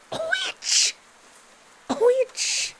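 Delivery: high, loud squeal on the word; loud, high-pitched outburst; dramatic exclamation. Acted. Excited reaction to the reveal.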